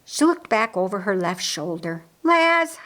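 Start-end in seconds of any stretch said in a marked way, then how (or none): 2.2-2.8 high, loud call of a name; reproachful call. A narrator's reading. Mary scolds her brother over honey butter, calling out.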